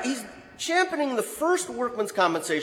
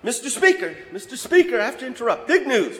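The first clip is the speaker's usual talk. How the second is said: high, eager, laughing interruption; pushy, excited interrupting with a laugh; breathless big news; 8 semitones higher than usual. Retold or imitated. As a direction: burst in giddy with news. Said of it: "comes running in with big news".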